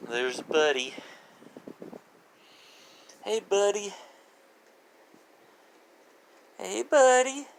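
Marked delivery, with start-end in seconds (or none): 3.2-7.5 much higher, rising call to the dog. Real, not acted. Owner trying to get Buddy's attention, high calling voice. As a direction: call him sweetly up high.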